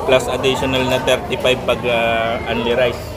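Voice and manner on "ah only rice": ordinary delivery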